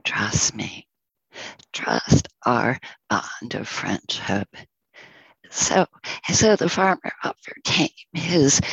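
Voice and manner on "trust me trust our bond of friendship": whispered, gentle plea; a hushed, earnest whisper of trust. Acted. Ox pleading softly with farmer.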